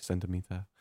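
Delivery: hushed mock movie-intro narrator; imitating a narrator. Teasing a friend over how he names a city, softly imitated narrator. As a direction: announce the place softly, lightly mimicking a narrator. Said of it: "you sound like a narrator".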